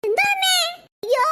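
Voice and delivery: high, loud cry of alarm; alarmed shout. Acted. Child shouts on seeing mother fall. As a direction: yell in sudden fright.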